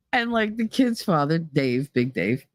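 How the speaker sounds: ordinary delivery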